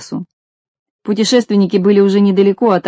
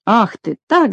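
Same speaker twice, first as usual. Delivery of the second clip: ordinary delivery, nothing marked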